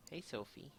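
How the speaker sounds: soft coaxing pet voice; talking to a dog. Real, not acted. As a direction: coo gently at the puppy. Greeting sleeping puppy on camera.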